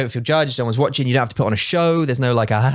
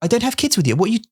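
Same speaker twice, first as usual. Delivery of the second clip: higher, baffled disbelief; baffled protest; 10 semitones higher, faster than usual. Retold or imitated. Husband telling the stranger wife he has no kids, higher in disbelief. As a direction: protest you've never met her. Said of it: "I'm like".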